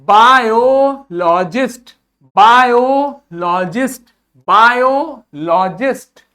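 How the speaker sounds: Biologist said slowly and clearly; slow teacher demo of biologist; slow model word. Shown as a demonstration. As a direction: stretch each syllable for the class. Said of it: "आगे पढ़ो".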